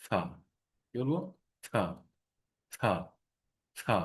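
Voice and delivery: থা with a light, airy th. Shown as a demonstration. Teacher modelling the th sound for students, light th. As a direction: say থা lightly, letting air slip past the tongue. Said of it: "আমরা হালকা করে বলবো".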